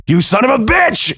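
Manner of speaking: husband shouting furiously at the man who hurt his wife. A narrator's reading. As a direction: roar it at him in fury. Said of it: "he shouted".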